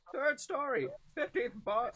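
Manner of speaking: put-on high German-accented callout; hammed-up German spotter. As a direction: report the target in a German accent. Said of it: "we're never gonna get away from the fucking German accents".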